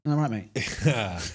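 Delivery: slow, stretched "Am I right, mate?"; co-host milking the in-joke, slowly; drawn-out tease. Shown as a demonstration. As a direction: drag the words out slowly to needle him. Teasing co-host repeat.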